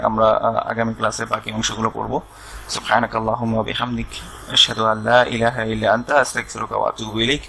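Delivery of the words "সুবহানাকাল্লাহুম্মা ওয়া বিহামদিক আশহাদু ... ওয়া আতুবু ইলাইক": closing prayer. Acted. Closing supplication.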